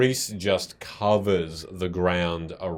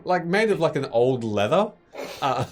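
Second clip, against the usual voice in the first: stifled laughter; 6 semitones higher, 5 dB louder than usual. Real, not acted. GM describing sack, suppressing laughter.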